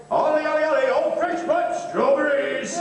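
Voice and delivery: loud market barrow call; hawking strawberries to passers-by; street cry. Acted. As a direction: bellow it like a fruit seller at a stall. Barrow boy calling out wares.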